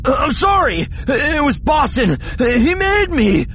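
high, loud, drawn-out wail; cowering coward pleading in terror; panicked apology. Acted. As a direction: blurt excuses as if facing death. Terrified Japet blames his accomplice.

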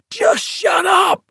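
shrill, high desperate scream. A narrator's reading. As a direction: scream it in pain and shame. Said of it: "Gribble screamed".

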